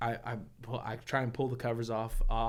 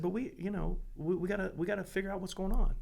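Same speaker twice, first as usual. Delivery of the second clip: higher, patient and reassuring; gentle coaxing; 8 semitones higher than usual. Retold or imitated. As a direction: coax him to open up, kindly. Father gently talking son through.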